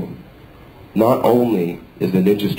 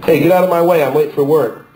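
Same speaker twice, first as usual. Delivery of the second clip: high and loud, late for work; loud impatient; 6 semitones higher, 4 dB louder than usual. Pretend. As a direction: shout him out of the way. Acting rushed commuter.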